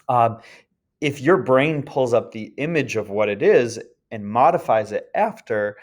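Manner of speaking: ordinary talk, nothing marked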